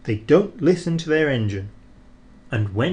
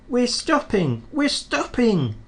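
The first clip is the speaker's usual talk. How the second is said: high, slowed calling; 11 semitones higher than usual. Acted. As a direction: announce it to the trucks. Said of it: "called Thomas".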